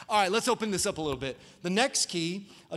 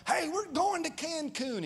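mock excited announcement; 10 semitones higher than usual. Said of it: "and like".

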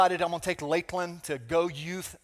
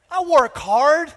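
slow, loud, much higher "I work hard"; loud mock self-pity; 9 semitones higher, 9 dB louder, much slower than usual. Pastor mocks his own self-pity while packing, slow loud whine. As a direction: ham up the wounded martyr. Self-pitying inner rant.

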